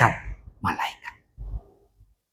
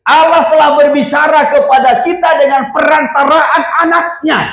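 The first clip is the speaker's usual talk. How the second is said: high-pitched, driving sermon delivery; forceful preaching; 10 semitones higher than usual. Real, not acted. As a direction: preach it with rising fervour.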